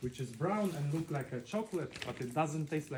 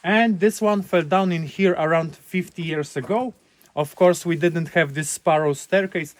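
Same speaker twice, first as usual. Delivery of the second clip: tour guide projecting; 3 semitones higher, 16 dB louder than usual. Real, not acted. Tour guide addressing group loudly.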